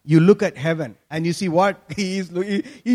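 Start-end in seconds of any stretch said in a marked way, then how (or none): none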